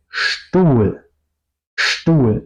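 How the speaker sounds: "Stuhl" begun with a clear SH, "shtool"; SH sound in Stuhl. Shown as a demonstration. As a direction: say "Stuhl" with a hushing SHT onset. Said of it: "No digo Stuhl, digo Stuhl". ("Stuhl" con sonido SH inicial, "shtul")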